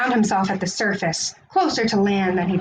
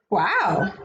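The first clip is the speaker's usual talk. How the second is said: awed wow; 3 semitones lower than usual. Acted. Kelp amazed.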